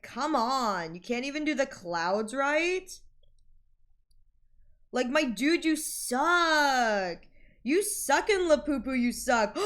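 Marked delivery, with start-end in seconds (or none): none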